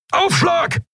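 hoher, gellender Warnruf vor dem Einschlag; brüll die Warnung hoch hinaus; Gebrüll. A narrator's reading. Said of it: "brüllte Keru". (Keru brüllt die Crew vor dem Einschlag an, laut gebrüllt)

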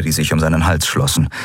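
ordinary delivery, nothing marked

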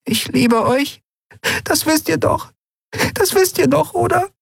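very high, small, fragile creature voice; tender and frightened, voice about to break. A narrator's reading. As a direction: Nelen saying goodbye to the crew, very high and fragile. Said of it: "mit zerbrechlicher Stimme".

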